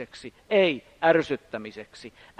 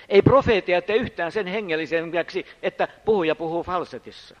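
ordinary talk

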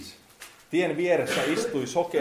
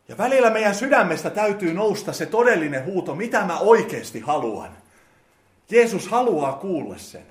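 saarnaava; 4 semitones higher, 7 dB louder than usual. Acted. Preacher at full force again.

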